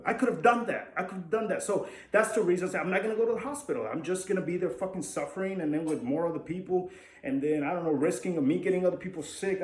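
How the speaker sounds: worked-up, indignant outburst; real frustrated rant. Real, not acted. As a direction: vent it hard, pitch pushed up. Ranting about useless hospital visits.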